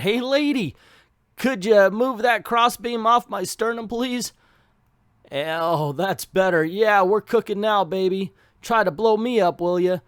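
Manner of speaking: lightly voiced thug asking a lady for help; tough guy voice. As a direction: play a cocky trapped thug. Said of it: "I'm not, by the way, trying the accent".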